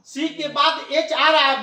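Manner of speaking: ordinary delivery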